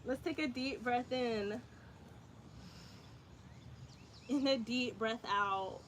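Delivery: soft, calm breathing cues; guided yoga. Acted. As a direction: ease the class through each pose. Leads yoga breathing and poses.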